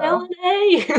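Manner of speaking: high, drawn-out playful call of the name; echoed name call. Retold or imitated. Friend echoing the movie call, high playful drawn-out name. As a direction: sing the name back teasingly.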